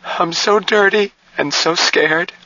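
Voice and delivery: high, drawn-out tearful wail; crying. A narrator's reading. Jill breaks down, dirty and scared, crying. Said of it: "She started to cry again".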